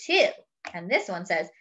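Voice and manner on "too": a chirpy high little voice for a sidekick; higher character voice. Acted. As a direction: pop it up high and bright. Reading character speech bubble.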